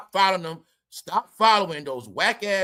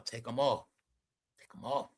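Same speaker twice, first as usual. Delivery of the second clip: imitating a hushed, much softer mother's order; quiet mother telling her; 7 semitones lower, 10 dB quieter than usual. Mother quietly tells daughter to remove shades, hushed. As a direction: murmur it low like a mom correcting her kid. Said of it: "She's telling her".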